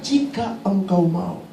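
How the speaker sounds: soft, drawn-out request; humble plea. Acted. Sick man submitting to the Lord's will, humble soft plea. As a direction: let it drop softly and slowly. Leper's plea repeated for congregation.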